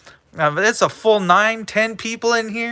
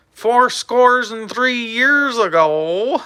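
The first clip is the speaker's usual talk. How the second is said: grand orator; 5 semitones higher, slower than usual. Pretend. Mock Gettysburg recital.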